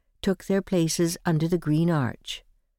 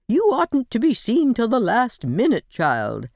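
put-on old lady scolding; 5 semitones higher, 3 dB louder than usual. A narrator's reading. Old aunt scolding bride.

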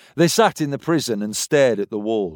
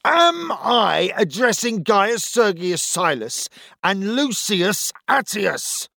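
slow, high, angry roar; 9 semitones higher, slower than usual. A narrator's reading. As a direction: bellow it out, slowly. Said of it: "roared the centurion".

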